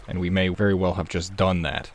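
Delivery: ordinary talk, nothing marked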